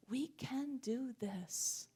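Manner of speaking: slow whispered promise. Real, not acted. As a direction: breathe it out very slowly, almost silent. Same line repeated as climax.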